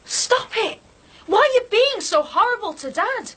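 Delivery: high, pleading cry of protest; a child yelling to make the fighting stop; upset shout. Acted. Son yells at his mother to stop attacking Dad, high upset shout.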